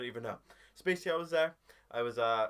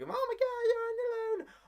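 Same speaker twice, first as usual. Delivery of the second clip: high, loud, wailing falsetto; exaggerated shrieking fretful wail; mock panicking mum; 5 dB louder, much slower than usual. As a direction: panic like a hammed-up worried mum. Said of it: "my mum started panicking".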